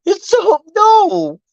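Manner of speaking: playful imitation of almost crying; mock teary. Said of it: "I almost started bawling".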